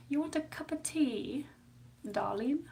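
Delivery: put-on high, soft British accent; Missus Doubtfire. Streamer playfully offering tea, put-on British accent. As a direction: play-act a quiet British lady's accent. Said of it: "I sound like Missus Doubtfire".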